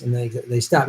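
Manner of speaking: ordinary talk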